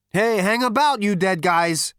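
high, loud, brash character voice; Zaphod. Acted. Zaphod's figure voice in the story.

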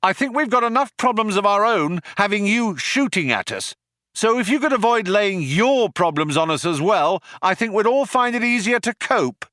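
high-pitched shouted appeal. A narrator's reading. Ford calls out to the cops shooting at them, shouted. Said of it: "Ford shouted out".

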